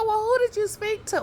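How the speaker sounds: imitating a suspicious boss; mock questioning boss. Telling how her superior questioned her, high and quick. Retelling phone call with superior.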